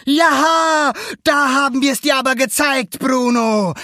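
high, loud, crowing triumph; triumphant shout. A narrator's reading. Alberto gloats at Bruno after landing, triumphant shout. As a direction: crow it out victoriously. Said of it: "rief Alberto".

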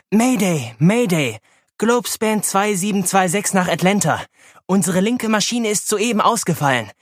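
urgent radio distress call; mayday radio call. A narrator's reading. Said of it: "Tracy setzte ihren Notruf ab".

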